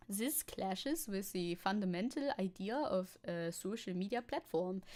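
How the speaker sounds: put-on measured German-accented expert reading. Streamer voicing Müller's quote to DW, put-on German accent. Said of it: "I'm bad at my German accent".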